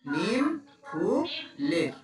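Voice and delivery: নিম ফুলের slowly, clearly spaced; slow model. Shown as a demonstration. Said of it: "তাহলে কি হলো?".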